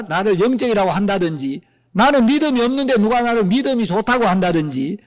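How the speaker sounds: ordinary talk, nothing marked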